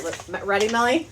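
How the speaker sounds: usual speaking voice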